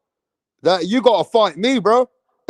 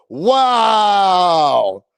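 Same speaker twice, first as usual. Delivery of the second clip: amazed wow. Real, not acted. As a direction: let the wow ring out, stunned. Reacting to chat news.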